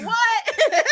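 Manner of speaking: high-pitched retold shriek of surprise; amazed outburst. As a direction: re-enact amazement, breaking into a laugh. Retells own excited reaction to scene.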